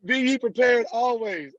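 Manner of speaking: lightly hammed preacher; a touch of pulpit. Mock preacher scripture line.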